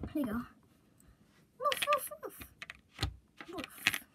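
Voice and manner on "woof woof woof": high yappy barks for a toy wolf; playful dog barks. Pretend. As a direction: bark like a little dog.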